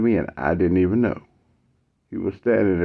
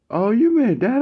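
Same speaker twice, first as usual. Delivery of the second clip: high, louder oh-that realization; sudden recognition pitched up; dawning realization; 4 dB louder than usual. Retold or imitated. Mike realizing what Matt means, high and surprised.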